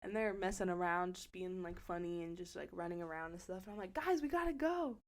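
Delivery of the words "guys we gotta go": urgent calling. Retold or imitated. Rounding up friends to leave.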